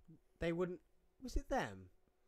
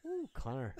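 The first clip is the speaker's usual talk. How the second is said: mock Irish lilt; slightly Irish; 3 dB quieter than usual. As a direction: imitate a teasing, lightly Irish coo. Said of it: "That was slightly Irish".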